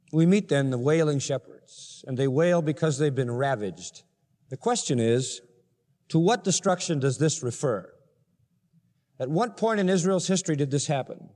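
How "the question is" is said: ordinary talk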